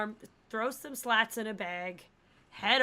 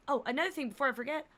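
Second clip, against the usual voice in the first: ordinary talk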